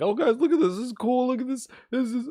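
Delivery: high, gushing hype streamer; excited streamer voice. Pretend. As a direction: mimic a thrilled streamer showing off.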